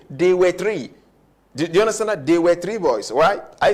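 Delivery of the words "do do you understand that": usual speaking voice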